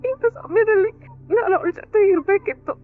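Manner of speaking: high, tearful pleading with a sob; crying. Acted. Bhoomi pleading with the officer for her jailed mother, crying. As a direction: beg through tears for her mother. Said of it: "ಅಳ್ಬೇಡ, ಸಮಾಧಾನ ಮಾಡ್ಕೊ".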